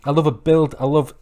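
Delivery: normal talking voice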